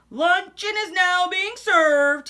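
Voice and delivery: high, loud, slow formal call; cook announcing. Acted. Pirate's cook calling everyone to lunch, high and loud.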